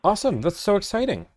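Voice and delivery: high bright delight; light up with joy; excited. Real, not acted. Reacts to availability.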